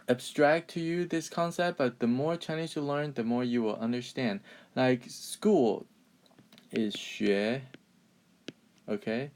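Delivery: ordinary talk, nothing marked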